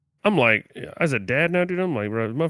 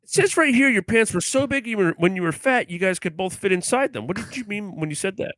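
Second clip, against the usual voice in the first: much higher, mock accusing questioner; mock interrogator; 8 semitones higher than usual. Host reading pants quote to co-host, hammed-up interrogator. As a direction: read the evidence like a hammy prosecutor.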